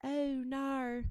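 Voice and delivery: exaggerated Antipodean accent demo; Aussie accent. Shown as a demonstration. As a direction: do a New Zealand and Aussie accent. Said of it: "New Zealand and Aussie accent".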